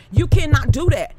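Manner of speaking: loud, firm scold; loud no-nonsense warning; forceful scolding. Real, not acted. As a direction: put your foot down hard. Angry scolding of former ally.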